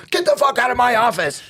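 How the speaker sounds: high, exasperated retold yell; imitated angry outburst. Lieutenant throwing inmate out of his office, imitated angry yell.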